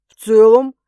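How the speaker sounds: surprised echo. A narrator's reading. Stas questioning her lukewarm praise, surprised. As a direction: throw the words back, puzzled. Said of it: "удивился Стас".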